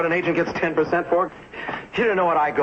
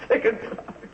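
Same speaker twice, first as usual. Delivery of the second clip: bitter laugh; 7 semitones higher, slower than usual. Acted. Alone after the fight.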